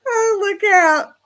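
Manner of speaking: high, slow laughing warning. Real, not acted. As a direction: laugh through a mock warning. Laughing at teasing comment.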